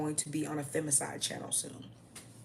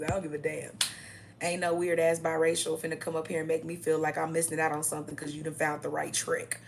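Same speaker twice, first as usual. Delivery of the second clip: ordinary talk, nothing marked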